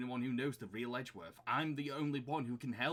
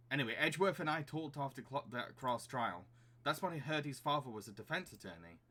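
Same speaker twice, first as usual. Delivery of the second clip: usual speaking voice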